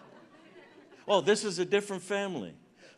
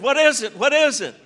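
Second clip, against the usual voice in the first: startled, louder and higher; a woken wife asking in alarm; sudden frightened questions; wife's alarm; 8 semitones higher, 11 dB louder than usual. Retold or imitated. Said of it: "She said".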